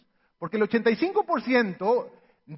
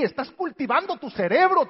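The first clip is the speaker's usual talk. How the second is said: ordinary delivery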